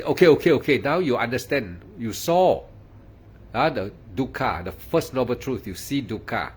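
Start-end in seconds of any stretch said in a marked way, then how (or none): 0.0-0.8 student's eager okay. Retold or imitated. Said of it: "You say".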